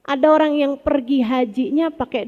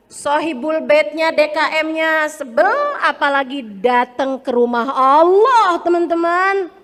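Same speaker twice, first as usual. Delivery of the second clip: ordinary talk, nothing marked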